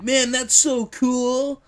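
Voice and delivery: high, loud, slow gushing voice; mock excited fan. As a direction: gush like a wowed fan. Quoting excited and dismissive people.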